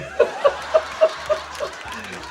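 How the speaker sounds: high, breathy burst of real laughter. Real, not acted. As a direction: crack up at the line. Laughing at the quoted line.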